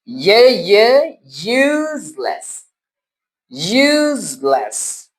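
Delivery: slow, drawn-out यूजलेस; phonics drill. Shown as a demonstration. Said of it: "phonics drill".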